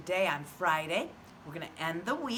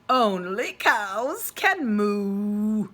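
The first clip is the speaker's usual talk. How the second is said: very slow, louder cow voice; 2 semitones higher, 8 dB louder, much slower than usual. A narrator's reading. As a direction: drag it out like a lazy cow. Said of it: "the cow speaks".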